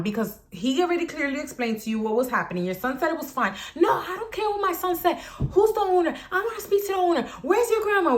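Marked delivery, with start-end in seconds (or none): 3.7-8.2 high-pitched mocking impression of an irate woman; demanding mother. Storyteller mimics the woman demanding the owner, high indignant voice. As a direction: imitate her complaining up high.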